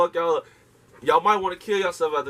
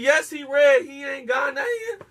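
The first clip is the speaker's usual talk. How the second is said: mock shout; 11 semitones higher, slower than usual. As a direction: bark it back like a sarcastic answer to a dumb question.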